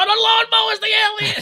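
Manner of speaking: high-pitched absurd alarm; hammed-up hysterical cry; mock mob panic. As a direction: imitate a crowd losing its mind. Acts out mob blaming lawnmower.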